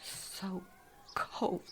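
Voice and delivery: faint whisper. Real, not acted. Woman whispers that she is so cold. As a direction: barely breathe it.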